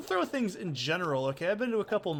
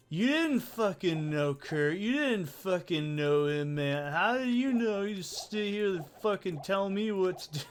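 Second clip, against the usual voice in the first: mock a permanently high singer yelling at someone; 5 semitones higher than usual. Singer onstage telling off a heckler, vacant awful voice. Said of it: "really vacant-sounding, awful voice".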